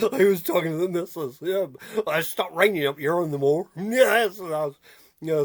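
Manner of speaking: normal talking voice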